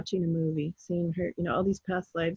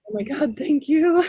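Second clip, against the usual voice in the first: relieved gratitude; 7 semitones higher, 6 dB louder than usual. Retold or imitated. Relieved after client's rubber duck story.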